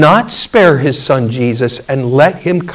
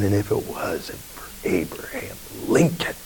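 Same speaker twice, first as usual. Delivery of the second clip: low, quiet, bitter mutter; a lightly voiced wounded soldier's bitterness; 4 semitones lower, 11 dB quieter than usual. Dying soldier blaming the president, quiet bitter mutter. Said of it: "with bitterness he said".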